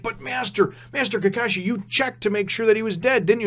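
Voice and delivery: lightly acted worried pupils, voice lifted; mock protesting students. Students challenge Kakashi on whether he checked, mock protesting. Students question their master, retold.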